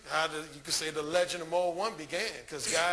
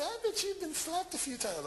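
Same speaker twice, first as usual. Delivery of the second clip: high put-on female voice, slightly softer; imitated women; 9 semitones higher, 4 dB quieter than usual. Women guessing he gets slapped, high imitated voice. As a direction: mimic a woman with a much higher pitch. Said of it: "They'll say".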